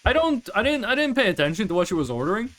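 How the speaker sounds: usual speaking voice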